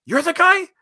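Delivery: high, loud amazed squeal of recognition. Pretend. Host squealing at guest in mock amazement, high and loud. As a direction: shriek it like spotting a celebrity.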